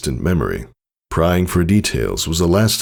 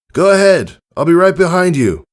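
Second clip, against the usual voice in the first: much higher, louder reassuring call; encouraging call; 6 dB louder than usual. Acted. Father reassuring daughter, loud encouraging call. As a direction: call out to reassure her over the storm. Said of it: "giving a reassuring smile".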